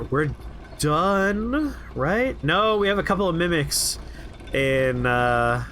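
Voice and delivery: ordinary talk, nothing marked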